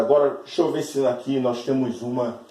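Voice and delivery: ordinary talk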